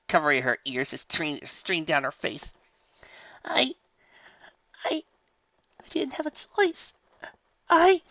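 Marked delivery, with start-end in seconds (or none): 3.4-8.0 high, faltering tearful stammer; stammering sob. Acted. Rainbow Dash stammering her excuse, high tearful voice. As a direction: break off mid-word.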